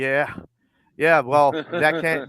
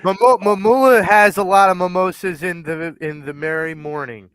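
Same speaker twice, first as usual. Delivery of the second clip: teasing tongue twister; 4 semitones higher, 8 dB louder than usual. Retold or imitated. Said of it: "It's like a tongue twister".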